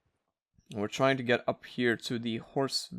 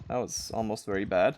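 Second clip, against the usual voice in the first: normal talking voice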